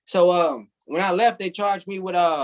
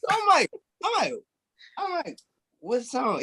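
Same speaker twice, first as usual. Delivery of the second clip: high squeaky disbelieving repeats, fading softer; stunned disbelief; 12 semitones higher, 4 dB quieter than usual. Retold or imitated. Hearing the officer likes his song, high stunned squeak. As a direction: squeak it out in total shock.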